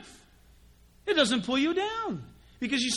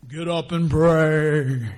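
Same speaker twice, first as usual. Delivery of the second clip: low, slow, loud mock-divine voice; booming TV God; 6 semitones lower, 8 dB louder, much slower than usual. God as TV people play him, ordering prayer in a slow low boom. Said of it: "he doesn't talk like that".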